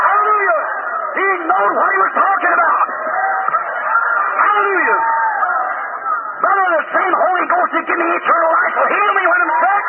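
high, fervent revival preacher; impassioned pulpit climax, pitched high; full-force preaching. Real, not acted. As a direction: cry it out to the congregation in exultation.

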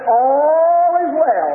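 acted cry of steadfast faith; triumphant declaration. Acted answer of faith in sermon.

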